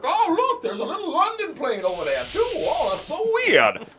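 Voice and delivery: high, laughing mock amazement; mock surprised observer. Mocking the network with high fake surprise. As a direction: play dumb, faking wide-eyed surprise.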